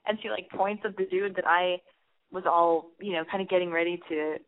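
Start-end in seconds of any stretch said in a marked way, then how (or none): none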